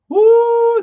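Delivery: a loud, high victory whoop; triumphant whoop. Real, not acted. Telling friends how he beat the college, a loud high whoop. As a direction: crow over beating the college. Triumphant cheer after beating rule.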